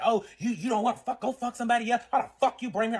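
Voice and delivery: high, heated mock tirade; angry comeback. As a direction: act out an indignant lover lashing out. Retold angry boyfriend in quarrel.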